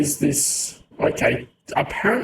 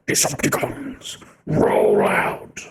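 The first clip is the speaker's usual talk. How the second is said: deep voice-changer boom; robot villain voice; 3 dB louder, slower than usual. Pretend. A deep robot villain commanding his troops. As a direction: bark the order like a robot warlord. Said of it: "You got a voice change".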